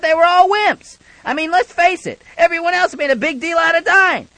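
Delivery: hammy eulogy peak. Acted.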